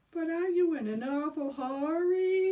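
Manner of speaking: loud high shout; mocking shout. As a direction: holler it after them. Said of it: "shouted Billy".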